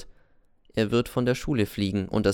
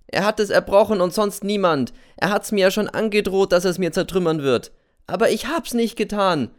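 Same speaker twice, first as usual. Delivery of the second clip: louder, much higher protest; desperate pleading shout; 9 semitones higher, 4 dB louder than usual. Acted. Boy N pleads his innocence to the teacher, loud and high. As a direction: plead your innocence at full voice. N defends himself loudly.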